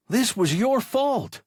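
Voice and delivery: high, loud accusing whine; hurt young pilot blaming his captain, high and loud; accusing boy. A narrator's reading. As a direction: throw the blame in a high voice.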